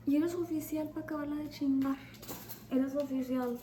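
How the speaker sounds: high, scornful mock question; mocking disbelief. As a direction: tease him with disbelief. Mocking the officer.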